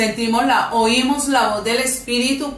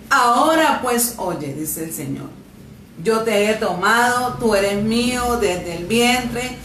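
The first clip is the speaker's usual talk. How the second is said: loud, fervent scripture reading; preaching at full force; 3 semitones higher, 3 dB louder than usual. Acted. Preacher reads Isaiah forty-four to the congregation, fervent. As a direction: proclaim the verses like a promise from heaven.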